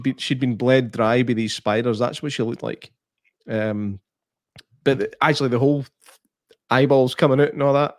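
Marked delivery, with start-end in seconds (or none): none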